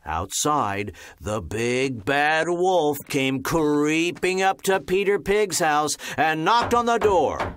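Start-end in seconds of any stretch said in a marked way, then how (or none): none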